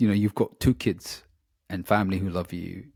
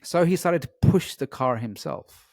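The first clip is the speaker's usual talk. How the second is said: usual speaking voice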